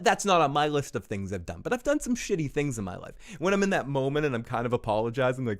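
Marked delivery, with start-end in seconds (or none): none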